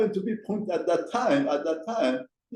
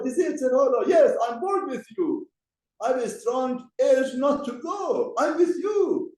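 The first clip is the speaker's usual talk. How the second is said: voiced Yetzer Hara; 9 semitones higher than usual. Voicing the evil inclination's coaxing.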